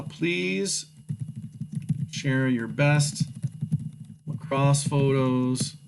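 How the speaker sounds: slow, trailing typing read-out; slow dictation. Shown as a demonstration. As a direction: speak it as you type it. Dictating a message while typing.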